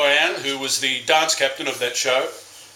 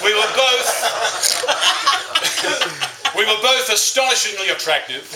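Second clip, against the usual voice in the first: breaking into laughter mid-phrase; can't get the words out for laughing; 6 semitones higher than usual. Real, not acted. Best man laughing through his boast.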